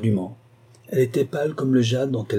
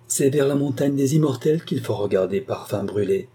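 ordinary delivery

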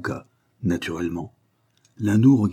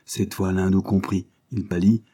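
ordinary delivery

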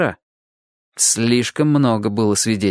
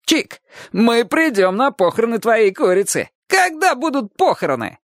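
put-on very high, jeering kid voice; high-pitched mischievous taunt; blurting schoolboy; 11 semitones higher than usual. A narrator's reading. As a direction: blurt it out to tease. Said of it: "Один из них выпалил".

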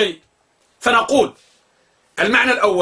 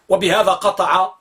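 ordinary talk

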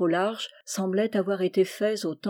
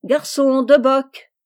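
loud, raised call to a waiter; higher, ringing café order; 5 semitones higher, 8 dB louder, slower than usual. A narrator's reading. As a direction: shout the order across the room. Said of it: "il s'écria".

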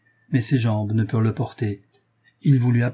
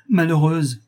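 higher-pitched, falling reproach; outraged exclamation; 5 semitones higher, 4 dB louder, slower than usual. A narrator's reading. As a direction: repeat the reproach in indignation. Enraged husband repeats his reproach.

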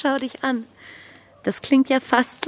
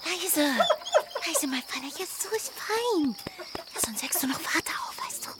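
softer, whispered soothing to the horse; hushed whisper; 4 semitones higher, 7 dB quieter than usual. Acted. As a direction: hush him so father won't wake. Anne quietly calms horse at night.

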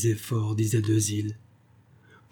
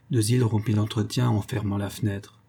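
ordinary delivery, nothing marked

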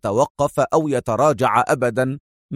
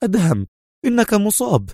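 high-pitched cry of dismay; dismayed, pitched well above usual; alarmed Mona; 7 semitones higher than usual. A narrator's reading. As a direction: cry out in alarm for a wounded comrade. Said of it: "هتفت منى في جزع".

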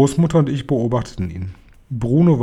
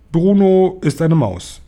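slow, higher and firmly projected; unruffled, spelling it out slowly; unshakeably calm; 6 semitones higher, 5 dB louder, slower than usual. A narrator's reading. Grandmother answering the furious father, unshakeably calm. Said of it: "antwortete meine Großmutter unerschütterlich ruhig".

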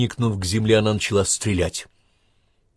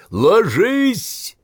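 very high, loud shouted warning; full-force battle scream; thunderous cry; 14 semitones higher, 5 dB louder than usual. A narrator's reading. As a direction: yell for everyone to take cover. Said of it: "раздался громогласный крик Иванцова".

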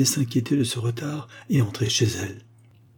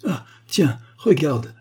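sudden idea; 4 semitones higher, slower than usual. Acted. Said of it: "dit-elle à Georges".

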